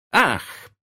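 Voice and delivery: put-on high-pitched girl's exclamation; shocked cry. A narrator's reading. Daughter catching her father cheating, shocked cry. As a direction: cry out in reproach, starting high. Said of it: "вскричала Венди".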